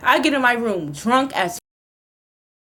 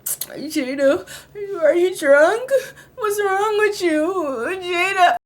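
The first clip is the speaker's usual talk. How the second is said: mocking brother; 6 semitones higher, much slower than usual. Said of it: "he over here like".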